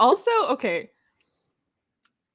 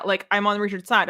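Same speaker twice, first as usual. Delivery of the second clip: normal talking voice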